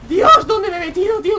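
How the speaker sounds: loud, high-pitched exclamation of alarm; alarmed shout. Real, not acted. Gamer stuck in a tank, loud alarmed cry. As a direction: yell out in real dismay.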